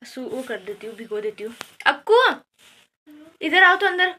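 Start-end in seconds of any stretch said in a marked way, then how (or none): 1.9-4.1 calling out. Real, not acted. Aunt calls Akku to come inside, calling out. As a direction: raise the voice to summon someone from outside.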